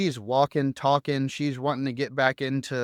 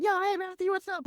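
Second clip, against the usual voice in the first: much higher, chirpy mock-sister greeting; all positive; 3 dB quieter than usual. Sister answering his call unaware, imitated all positive. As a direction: imitate a carefree hello way up high. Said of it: "All positive".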